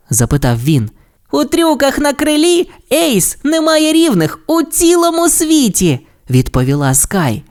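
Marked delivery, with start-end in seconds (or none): 1.3-6.1 very high cartoon pup voice; admiring pup pilot. A narrator's reading. Sky praises Ace's wing stunts, high admiring pup voice. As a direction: boast about your idol, high-pitched.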